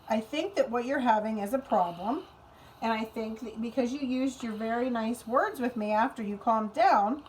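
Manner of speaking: ordinary talk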